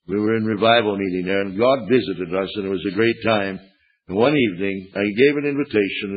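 normal talking voice

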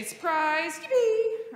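high, loud joyful calls; excited cries. Shown as a demonstration. Pastor modelling excited words for children, loud and high. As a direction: cheer it out for the kids. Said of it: "what are some other things we say".

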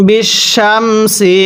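বিশামসি slowly, the শিন thick and the সিন thin; careful tajweed. Shown as a demonstration. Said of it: "শিনটি উচ্চারণের সময় মোটা করে পড়তে হবে".

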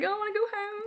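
high pouty mock homesick voice; mock homesick whine. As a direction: play the homesick baby, half joking. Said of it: "getting a bit homesick and being like".

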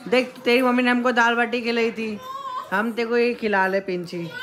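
sweet talk to a pet bird. Real, not acted. Talking to the pet in baby voice.

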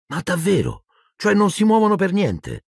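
very high-pitched, astonished questions; amazed. A narrator's reading. Wizard boy hears that ordinary photos stay still, amazed. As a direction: let the pitch leap up in disbelief. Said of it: "Ron sembrava molto stupito".

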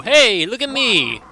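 high, loud attention-grabbing call. Acted. Player calling for attention in game, high loud call. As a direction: call out high, punching the hey.

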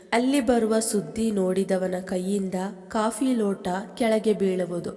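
usual speaking voice

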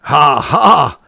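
loud, high acted ha-ha; hearty laugh. A narrator's reading. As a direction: laugh out as the merry professor. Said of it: "laughed the professor".

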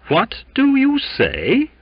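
high, slow, loud question; slow, challenging demand; deliberate pronouncement. A narrator's reading. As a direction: pronounce it with weight, drawn out. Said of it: "pronounced Captain Alastoon".

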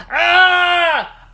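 loud groaning wake-up cry; mock agony of a hungover dad waking. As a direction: let out a pained, hungover moan. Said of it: "up lurches a thirsty and very hungover".

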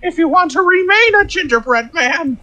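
very high, put-on gingerbread man voice; playful high-pitched singsong taunt; storybook taunt. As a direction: chant it in a squeaky high cartoon voice. Quotes the gingerbread man's taunt.

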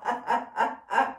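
rhythmic ha-ha-ha exercise laugh; staccato laugh. Shown as a demonstration. Teacher ending a warm-up chant, staccato laughing. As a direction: let the chant spill into laughter.

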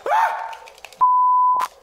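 sharp high yelp; frantic scream. Real, not acted. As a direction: shriek in panic. Frantic scream while waiting.

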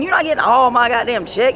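ordinary delivery

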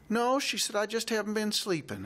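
put-on high child's voice, earnest; little girl voice. Quoting the girl Molly.

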